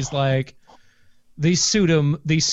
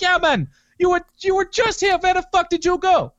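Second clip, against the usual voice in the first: high-pitched imitation of an Iranian accent; mock indignant Iranian accent, pitched well up; 13 semitones higher, 3 dB louder than usual. Gas station clerk scolding an absent regular, high Iranian accent. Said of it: "I've had that accent stuck in my head".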